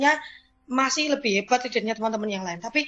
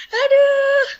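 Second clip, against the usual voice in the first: 1 aduh menangis; 9 dB louder than usual. Real, not acted. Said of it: "sering banget nangis".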